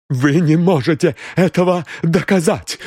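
high, stressed ЭТОГО, breathless; gasped defiance. A narrator's reading. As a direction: throw the challenge back, first time. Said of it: "выдохнула дама". (выдохнула с вызовом)

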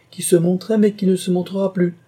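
high, loud firm retort; emphatic correction. Acted. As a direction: correct him with spirit. Heroine firmly corrects the marquis.

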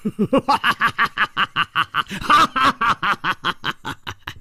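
high, booming head-back laugh; a wild, mocking laugh from a brash hero; hearty laugh. A narrator's reading. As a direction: throw your head back and roar with laughter. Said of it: "胡铁花仰天大笑".

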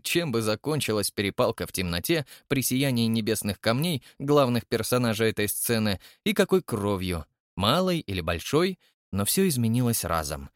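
ordinary delivery

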